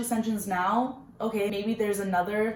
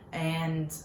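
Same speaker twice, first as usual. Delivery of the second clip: usual speaking voice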